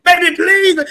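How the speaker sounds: beg, a touch hammed-up; loud mock plea from the wedding scene; Dwayne begging. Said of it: "You know, Dwayne".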